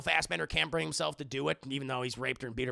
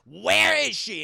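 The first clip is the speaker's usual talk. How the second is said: loud, higher shouted demand; furious yell; 6 semitones higher, 10 dB louder, slower than usual. Retold or imitated. Master yells at a slave after slapping him, loud.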